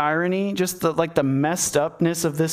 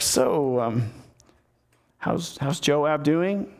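awkward small talk; much slower than usual. Retold or imitated. David facing Uriah with a guilty conscience, acting awkward. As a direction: stall and stumble through acted small talk. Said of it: "this really awkward small talk".

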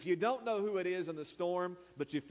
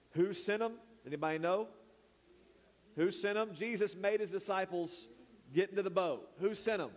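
preaching call-out; 5 semitones higher than usual. Acted. Pastor quizzes congregation, raised voice.

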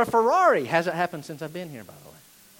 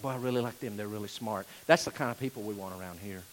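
much softer, lower, approving; admiring churchgoer; 12 semitones lower, 10 dB quieter, faster than usual. Retold or imitated. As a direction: murmur approval of the clever newcomers. Voiced church members admiring smart people.

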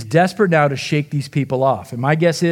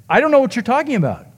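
higher and fast, a sharp retort; imitating Peter snapping back, much higher and fast; snapped denial; 9 semitones higher, faster than usual. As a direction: rattle it off high and quick like a cornered man snapping. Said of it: "Luke says that Peter snapped".

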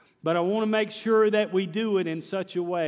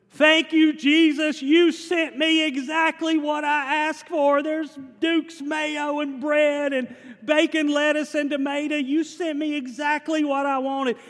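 put-on much higher and louder, a thrilled old woman's voice; elderly church lady overjoyed at the groceries on her doorstep; 9 semitones higher, 5 dB louder than usual. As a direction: gush your thanks like a delighted grandma.

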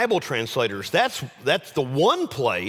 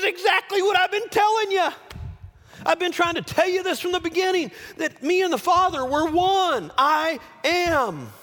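very high, raised-voice exclamation; exasperated shout. Acted. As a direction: cry it out as if they should know by now. Jesus' exasperated answer, acted.

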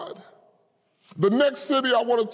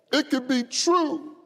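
ordinary talk, nothing marked

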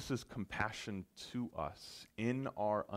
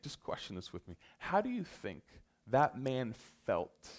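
ordinary talk

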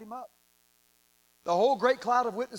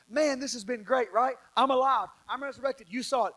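higher, lively casual quote; upbeat paraphrase; 6 semitones higher, 3 dB louder than usual. Retold or imitated. Preacher voicing risen Jesus to his disciples, upbeat paraphrase. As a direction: lightly act out an excited friend.

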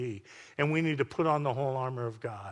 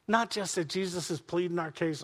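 ordinary delivery

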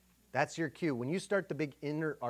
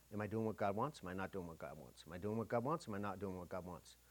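softer, fretful inner voice, each "not" line dropping lower and softer; anxious self-questioning; 5 semitones lower, 9 dB quieter than usual. Retold or imitated. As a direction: mimic a worried mind going in circles.

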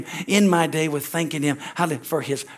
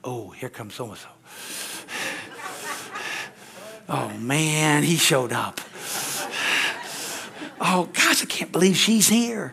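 annoyed groan; 4 dB quieter, slower than usual. Retold or imitated. Church member annoyed a complainer arrived, a soft mock groan. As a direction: sound let down they showed up. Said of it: "or do they go".

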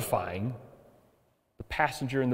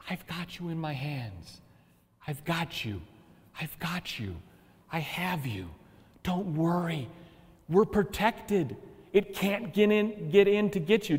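softer, gentle and protective; reassuring voice of God; 4 semitones higher, 3 dB quieter than usual. Acted. God comforting his frightened people, softer and tender. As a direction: murmur it like holding someone safe.

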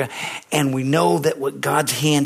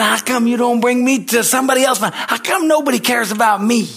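higher, self-pitying plea to the Lord; mock-whining prayer, a little higher; whiny complaint to God; 9 semitones higher, 4 dB louder than usual. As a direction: sulk at God, feeling sorry for yourself.

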